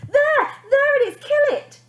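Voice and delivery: high, loud frantic shrieks; frenzy. Acted. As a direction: panic and point at the spider. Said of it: "in quite a frenzy".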